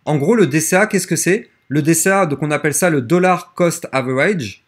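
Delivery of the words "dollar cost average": English term in a French accent. Real, not acted. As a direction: say the English words with French pronunciation. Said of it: "excusez-moi pour mon accent". (anglais avec l'accent français)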